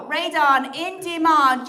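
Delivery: ordinary delivery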